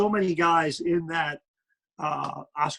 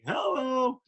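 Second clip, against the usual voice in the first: much higher, excited hello; catchphrase hello; 9 semitones higher than usual. Shown as a demonstration. Broadcaster reacting to an impressive play, excited hello. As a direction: greet a great play with delight. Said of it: "I'm like".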